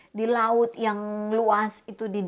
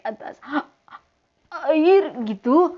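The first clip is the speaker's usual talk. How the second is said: doubtful fear; 6 semitones higher, 6 dB louder than usual. Acted. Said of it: "dia mulai ada rasa takut".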